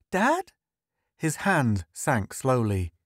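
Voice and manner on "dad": put-on very high child's query; questioning little boy. A narrator's reading. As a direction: appeal to father in a child's pitch. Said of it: "finally at his father".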